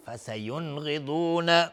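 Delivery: chanted "فسینغضون" with a clear, short n; no ghunna. Shown as a demonstration. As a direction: go from n to gh in "فسینغضون" without humming. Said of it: "غنه نمیخواد". («فسینغضون» با اظهار نون، بدون غنه)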